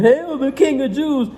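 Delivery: high, slow mock praise; mocking hail. Soldiers mocking the prisoner, in slow high sneering praise. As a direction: sneer it like a fake salute to a fake king. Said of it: "they doing this in mockery".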